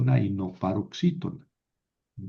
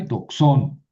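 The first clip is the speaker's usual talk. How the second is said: doxón with a louder, stressed -ÓN; stress on last syllable; 4 dB louder than usual. Shown as a demonstration. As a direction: land hard on the final syllable of doxón. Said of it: "el acento se va a ir a la última". (doxón con acento fuerte en la última sílaba)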